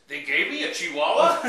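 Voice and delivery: loud, high startled question; shocked disbelief. Acted. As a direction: act stunned by the news. Punchline, blind man shocked.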